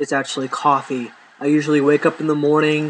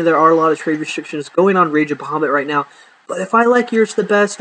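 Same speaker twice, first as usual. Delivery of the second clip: ordinary talk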